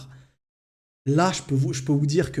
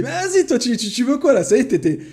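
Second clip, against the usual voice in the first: put-on much higher, louder teasing kid brother; mocking brother; 9 semitones higher, 5 dB louder than usual. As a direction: tease the big brother for fussing. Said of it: "Il se met à rire".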